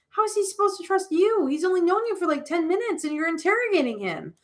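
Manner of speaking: put-on high, indignant girl's voice; indignant defence. Alina defending B C to Sam, indignant. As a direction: pitch it up, protesting. Acted quarrel, friend defends him.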